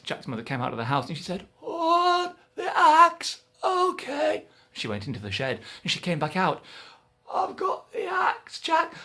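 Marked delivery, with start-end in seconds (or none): 1.6-4.5 high, loud flustered mum; high muddled mum; Mum's voice. Acted. Mother's voice in story. 7.3-8.9 high, slow proud mum; high, slow cheerful mum; Mum's voice. Acted. Mother returns.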